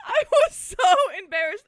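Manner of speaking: high laughing embarrassed. Real, not acted. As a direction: squeal it through laughter.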